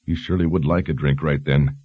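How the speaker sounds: plain reading voice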